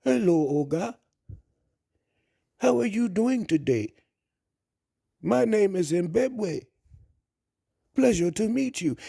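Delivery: polite woman introducing herself in a mock African accent. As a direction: put on an African woman's accent, pitched high. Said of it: "in her accent".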